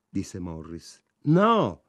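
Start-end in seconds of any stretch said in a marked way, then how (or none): 1.2-1.8 a sharp, much higher, louder refusal; flatly refuse, voice jumping up; insistent no. A narrator's reading. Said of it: "insistette Ida". (no deciso e insistente)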